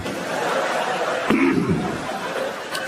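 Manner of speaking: a pointed throat clear that breaks the sentence; throat clear mid-sentence. Shown as a demonstration. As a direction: clear your throat right in the middle of the line. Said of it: "don't clear your throat in the middle".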